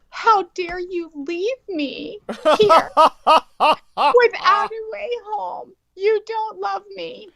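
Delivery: high, slow, mock-wailing teen; daughter's wailing reply. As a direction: wail it like a wounded daughter. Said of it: "her reply was".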